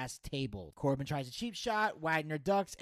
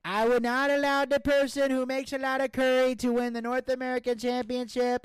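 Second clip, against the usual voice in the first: high, loud campaign voice; presidential candidate voice; 8 semitones higher, 4 dB louder than usual. Pretend. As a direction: boom it like a candidate at a rally. Mock presidential campaign speech.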